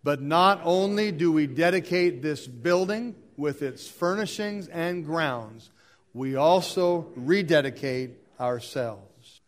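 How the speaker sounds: firm, deliberate dedication reading; liturgical recitation. Acted. Everyone reads all together to rededicate themselves, firm and deliberate. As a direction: recite it solemnly, phrase by phrase.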